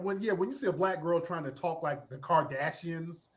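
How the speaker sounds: ordinary delivery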